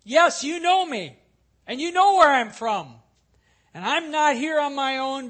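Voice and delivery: loud and full, calling over the crowd, easing off at the end. Acted. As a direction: proclaim it so the whole temple hears. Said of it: "Jesus says in a loud voice".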